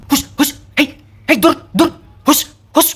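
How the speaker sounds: short, high-pitched cries to chase it away; acted high shooing calls; shooing a dog. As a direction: shoo it off in sharp bursts. Acts out people shooing the dog.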